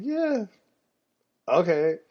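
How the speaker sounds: squeaky high "Yeah. Okay." playing innocent; mock oblivious agreement. Letting classmates believe dad has no job, high and airy.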